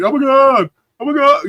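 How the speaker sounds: hammed-up, high-pitched alarm; mock panic. Streamer mimicking people walking down the street, mock panic. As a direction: imitate frightened passers-by, voice shooting up.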